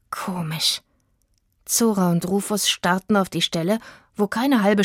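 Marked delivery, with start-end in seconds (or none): 0.1-0.8 leise, geflüsterte Verwunderung; geflüstert. Acted. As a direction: hauch es verwundert. (verwundert flüsternd)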